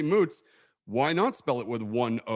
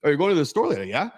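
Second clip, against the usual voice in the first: Hawaiian pidgin lilt ending on a rising "yeah?"; Hawaiian rising tag; 3 semitones higher, 6 dB louder, faster than usual. Shown as a demonstration. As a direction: ask it Hawaiian style, tipping up on "yeah?". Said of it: "So they'll be like".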